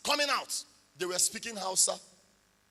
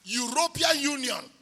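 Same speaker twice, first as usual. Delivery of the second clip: louder, driving the punchline home; triumphant preaching; 2 semitones higher, 8 dB louder than usual. Real, not acted. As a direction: build to the win. Preaching climax of the testimony.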